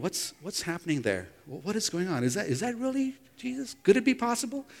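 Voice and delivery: wondering aloud. Retold or imitated. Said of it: "And wondering".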